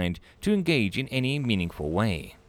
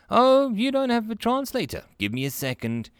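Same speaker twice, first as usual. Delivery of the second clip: bright high welcoming voice, clearly higher; a cheerful human clerk, pitched up; friendly receptionist Melanie; 12 semitones higher than usual. Acted. Clerk greets a nervous alien visitor, high and friendly.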